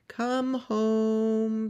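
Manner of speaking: long, high, loud call; sustained call summoning a child home. Acted. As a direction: stretch it out across the field. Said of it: "calls to her little boy".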